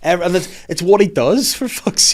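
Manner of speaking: loud, high exasperated complaint. Real, not acted. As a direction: throw up your hands in disbelief. Exasperated about the devil.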